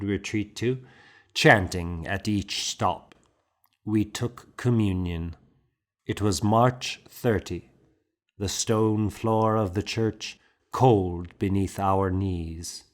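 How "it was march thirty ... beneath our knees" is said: plain reading voice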